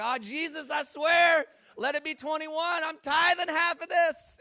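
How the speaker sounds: high, loud gambler's plea; frantic bargaining, high and loud; mock desperate prayer. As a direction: shout a hammed-up bargain with Jesus. Mock desperate prayer at blackjack table.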